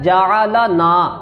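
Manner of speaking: جعلنا slow, every letter with zabar, ja-a-la-na; جعلنا drawn out, all four letters voweled; correct recitation. Shown as a demonstration. Teacher showing students the correct reading, slow. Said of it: "مثال دیتا ہوں".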